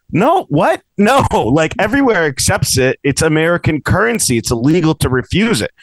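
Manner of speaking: high-pitched, disbelieving objection; retold indignant protest. Customer argues with laundromat lady over coins, indignant. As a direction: re-enact an exasperated argument.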